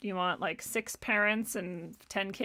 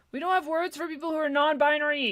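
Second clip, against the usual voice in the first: mocking quote; 5 semitones higher, 3 dB louder, faster than usual. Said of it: "mocked opponent quote".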